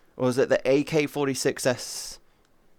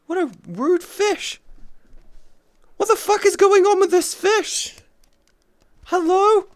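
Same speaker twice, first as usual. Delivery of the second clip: high, loud indignant squeak at a fish; outraged at a fish, way up high; baffled squeal; 5 dB louder than usual. Real, not acted. Streamer shocked by a rude fish, high squeaky outburst.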